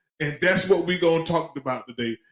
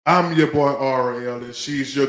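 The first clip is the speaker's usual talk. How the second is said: loud, slow show opener; announcer intro; 3 semitones lower, 7 dB louder, slower than usual. Acted. Host opening the live show, loud announcer hype.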